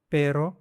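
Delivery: पेरो with a single tapped r; पेरो, light one-tap r; Spanish pero. Shown as a demonstration. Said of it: "ऐसे आपको इसको sound आ जाएगा". ("पेरो" में हल्का एक बार का र)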